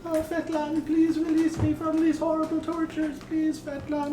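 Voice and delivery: high-pitched pleading prayer; desperate praying. Acted. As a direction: beg the god for release in a thin high voice. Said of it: "starts praying to, uh, Fetlan".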